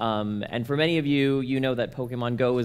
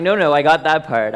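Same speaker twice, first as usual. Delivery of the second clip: raised, loud protest to mom; 3 semitones higher, 10 dB louder than usual. Retold or imitated. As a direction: push back loudly, mock indignant.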